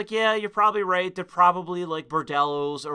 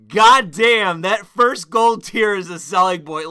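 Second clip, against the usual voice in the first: impressed exclamation; 8 dB louder than usual. Retold or imitated. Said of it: "I was like".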